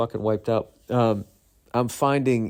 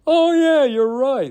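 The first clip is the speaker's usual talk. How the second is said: loud, very high squeal of realization; mock your own caving in a high voice; hammed-up high-pitched concession; exaggerated agreement; 6 dB louder, slower than usual. Retold sheepish agreement with wife.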